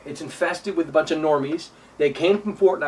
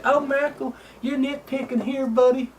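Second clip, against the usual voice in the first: high mocking nasal-free whine of a fan; mocking whiny fan; 9 semitones higher than usual. Mimicking fans who say he is nitpicking, high mocking voice. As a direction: put on a high, silly fan voice. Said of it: "you guys are gonna sit there and say".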